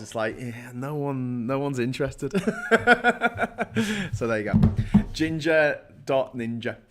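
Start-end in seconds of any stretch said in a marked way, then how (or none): 2.4-4.1 high laugh; real laugh. Real, not acted. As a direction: laugh freely. Laughs at own joke.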